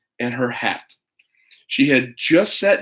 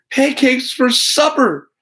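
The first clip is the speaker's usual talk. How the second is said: high, drawn-out happy cry; Toby cheering about pancakes, high and slow; excited shout; 11 semitones higher, 4 dB louder, slower than usual. Acted. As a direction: call out the treat with joy. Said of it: "shouted Toby".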